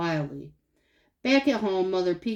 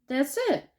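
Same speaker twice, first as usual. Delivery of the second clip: put-on sulky, put-out little girl; grumpy; 6 semitones higher than usual. A narrator's reading. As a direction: say it grumbling, fed up. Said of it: "in a grumpy voice".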